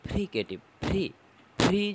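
"ফ্রিকেটিভ, ফ্রি" with a voiceless f; teacher modelling the f in "ফ্রিকেটিভ, ফ্রি"; voiceless f demo. Shown as a demonstration. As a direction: air only on the opening f of "ফ্রিকেটিভ, ফ্রি". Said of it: "দেখবে vocal cord কাঁপবে না".

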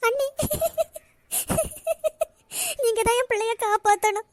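sobbing plea; wailing, tearful appeal; crying. Acted. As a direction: beg for help through sobs. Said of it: "அண்ணி, அழாதங்க அண்ணி".

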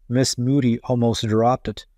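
ordinary delivery

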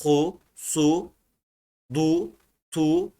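"Hu, su, du, tu" with thick Arabic consonants and a long back u; harekeli kalın okunuş. Shown as a demonstration. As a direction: read each syllable separately, heavy and rounded. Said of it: "burada hareke varmış gibi okuyorum". (kalın u sesiyle, hece hece)

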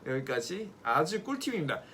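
ordinary talk

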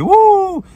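high, loud "woo"; excited whoop. Real, not acted. Host hyping viewers about being in Bradford, excited whoop.

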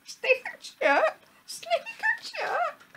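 very high, slow squeaky repeats; tiny-bird snicker-chirps; sniggering chirping bird. A narrator's reading. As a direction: tweet the words in falsetto. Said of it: "sniggering and chirping at the same time".

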